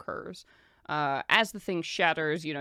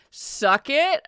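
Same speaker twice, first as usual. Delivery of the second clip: gloating taunt; 9 semitones higher, 10 dB louder than usual. Acted.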